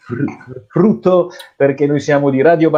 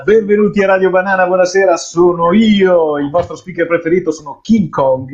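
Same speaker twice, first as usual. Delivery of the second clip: excited DJ; 5 semitones higher than usual. Acted. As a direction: hype up the listeners. DJ opening the show.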